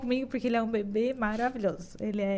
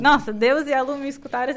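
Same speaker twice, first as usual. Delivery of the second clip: ordinary talk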